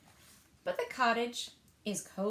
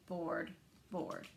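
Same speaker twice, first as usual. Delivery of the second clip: bored drone; 4 semitones lower, 4 dB quieter than usual. Acted. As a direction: lower and softer, bored bear. Bear bored alone in cave.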